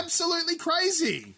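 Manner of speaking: high, amazed exclamation; excited. Real, not acted. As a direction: blurt it out in disbelief. Amazed at old plans.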